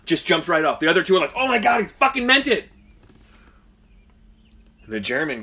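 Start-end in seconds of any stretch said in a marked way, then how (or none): 1.4-2.7 high, loud cry of disbelief; hammed-up horrified exclamation; mock shocked yell. Workers watching their friend jump, mock shocked yell.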